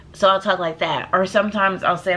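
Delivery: usual speaking voice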